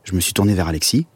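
usual speaking voice

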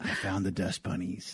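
low, quiet spooky ghost voice; dark whisper behind you. Pretend. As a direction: creep them out from under the bed.

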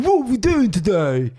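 cheeky broad lad asking brightly; chavvy bin voice. Retold or imitated. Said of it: "he speaks a bit like this".

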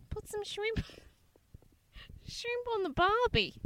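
high, soft mock Australian accent. Host mocks co-host's Australian line, high mock Australian accent. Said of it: "I didn't even know what that accent was".

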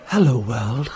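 mock-sexy advert catchphrase; a bit sexier. Dad retrying the line on request, a bit sexier. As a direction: give the tagline a seductive lilt, without pushing it. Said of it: "needed to be a bit sexier".

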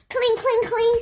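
high, chanted little voices; busy children cleaning. Acted. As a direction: chirp it in rhythm while working.

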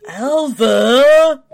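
high, loud, laughing screech of the name; laughing shriek, Vampire's Kiss style; Vampire's Kiss imitation. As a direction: wail the name like the movie.

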